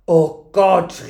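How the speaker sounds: loud, appalled exclamation; shocked cry. A narrator's reading. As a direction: cry out as the aghast innkeeper. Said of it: "rief der Wirt".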